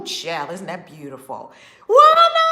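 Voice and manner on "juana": high, loud happy call; calling out to a viewer who just joined, thrilled; excited shout. Real, not acted. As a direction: shout her name with joy.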